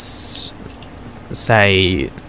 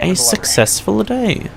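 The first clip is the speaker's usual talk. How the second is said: Maya's voice; 11 semitones higher than usual. Acted. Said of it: "And she says".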